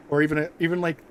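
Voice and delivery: normal talking voice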